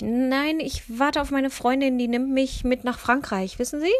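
loud, high-pitched nervous explanation; flustered excuse. A narrator's reading. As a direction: blurt an excuse when caught out. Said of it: "stotterte ich". (verlegene Ausrede, laut und hoch)

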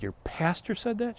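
a soft, hushed, disbelieving question; stunned hush. Retold or imitated. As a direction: ask it quietly, barely believing it. Shocked at what the pastor said.

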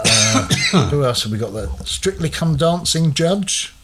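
usual speaking voice

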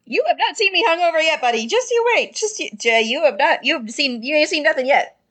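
lightly voiced, quick boast; a hint of drunken bravado; cocky Phineas. Phineas boasting to his buddy, lightly cocky. Phineas bragging about hangovers.

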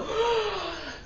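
sharp high gasp for breath; gasp for air. Shown as a demonstration. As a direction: suck in air after holding breath. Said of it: "no puedes exprimir más aire".

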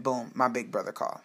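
ordinary delivery

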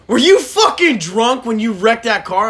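mock the mom's furious phone rant; hammed-up angry mother on the phone; mad mom quote. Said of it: "she's mad at me on the phone".

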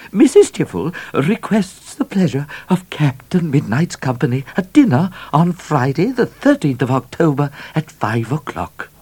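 high, measured voice of a hostess; formal invitation. A narrator's reading. Hostess inviting Captain Midnight to dinner, formal and high. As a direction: read it out primly, pitched high.

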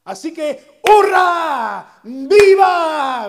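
building from eager to a loud, high cheer; rising jubilant hurra and viva; excited crowd cheer. Acted. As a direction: celebrate like a crowd expecting a victory. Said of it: "tenían una idea de".

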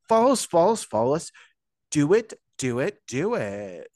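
hammed-up plea, starting high and loud; repeated pushy urging that drops at the end; Lefty. Host urging listeners to follow, mock Lefty impersonation. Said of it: "That was my best lefty impersonation".

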